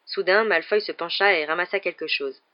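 ordinary delivery

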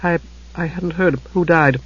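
soft, high, halting woman's voice; faint and taken aback. A narrator's reading. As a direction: falter faintly over the words. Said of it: "she said faintly".

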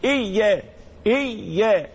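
"إيا" with the y held as two letters; doubled ya. Shown as a demonstration. Teacher showing the doubled ya, held shadda. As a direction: lean on the ي in "إيا" before opening to aa. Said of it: "الياء مشددة".

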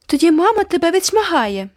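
put-on high, loud child's cry; shrill cry. A narrator's reading. Girl crying a threat at the boy, loud high. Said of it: "скрикнула Айві".

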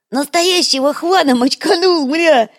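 high, loud gleeful creature yell; excited goblin shout. Acted. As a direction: crow about the kill. Goblin brags again.

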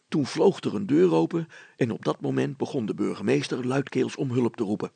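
plain reading voice

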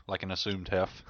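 ordinary talk

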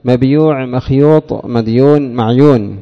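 مبيوع مخيوط مديون معيون said with the full ya and waw, the Tamim way; each word spelled out the Tamim way, with the full ya and waw kept; Tamim dialect forms. Shown as a demonstration. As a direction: sound the ya and the long u in each word, as Banu Tamim do. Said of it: "بنو تميم يصححون اليائية فيقولون".

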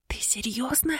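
unvoiced whisper in her ear. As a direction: whisper it, secretive. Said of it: "зашептала мне на ухо Миника". (шёпот на ухо)

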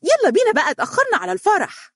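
put-on very high child's squeal; shouting little girl. A narrator's reading. Little girl hurrying everyone to the party, high-pitched cry. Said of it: "هتفت مهرة بصياح".

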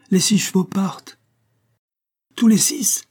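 shocked question rising high; alarmed surprise. A narrator's reading. Colonel alarmed that all six horses start.

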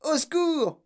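high-pitched pleading for help; panicked cry. A narrator's reading. Mother begging help for her daughter, panicked cry. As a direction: wail each plea high, in separate bursts. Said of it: "hurla madame Beauregard".

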